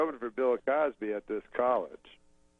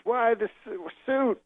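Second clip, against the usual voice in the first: high-pitched impression in broken fragments; Bill Cosby; 10 semitones higher, slower than usual. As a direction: imitate a comedian questioning in fragments. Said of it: "he doesn't use full sentences".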